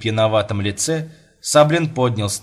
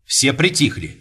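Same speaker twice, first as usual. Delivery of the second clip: ordinary delivery, nothing marked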